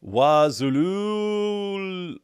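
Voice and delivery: mock voiceover. Streamer asking for the voiceover, dramatic drawn-out name. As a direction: stretch the name out like a movie trailer. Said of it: "that fantastic voiceover that sounds like".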